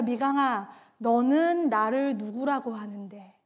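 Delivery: soft, low, in one flowing phrase; hushed, low voice of Jesus asking; gentle divine question. Retold or imitated. Jesus breaks silence, softly asks her.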